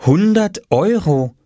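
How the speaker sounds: high, slow astonishment; drawn-out amazed tone; amazed reading aloud. A narrator's reading. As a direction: read the sign out in wonder. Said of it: "las Mama auf dem kleinen Papierschild ... und staunte".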